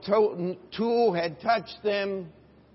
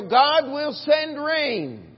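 loud, high, very slow declaration; slow ringing prophecy, louder and higher; bold promise; 6 semitones higher, 6 dB louder, much slower than usual. Acted. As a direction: proclaim it like a prophet.

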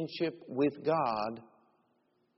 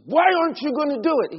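high, loud and fast angry demand; mad boss; 13 semitones higher, 10 dB louder, much faster than usual. Retold or imitated. Boss furious that Dave refuses the cabinet, shouting. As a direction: snap the question in a rage. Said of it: "he just got mad".

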